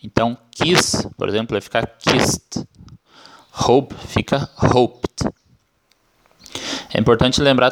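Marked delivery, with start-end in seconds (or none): 1.9-2.7 kissed ending in a crisp T sound. Shown as a demonstration. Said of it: "com som de T". 4.6-5.3 hoped ending in a T, "hopt". Shown as a demonstration. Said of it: "com som de T".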